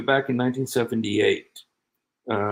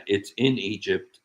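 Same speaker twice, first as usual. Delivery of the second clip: ordinary talk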